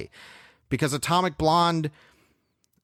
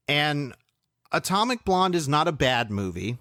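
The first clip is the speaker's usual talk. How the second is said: ordinary talk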